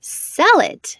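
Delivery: high, loud shocked outcry; cried out. A narrator's reading. Widow aghast at selling her husband's car, high loud cry. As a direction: gasp it out, appalled. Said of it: "she cried".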